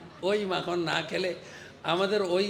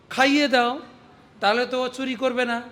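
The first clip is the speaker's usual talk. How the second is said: loud insisting; 5 semitones higher, 6 dB louder than usual. Acted. Pleading gopi insists to mother.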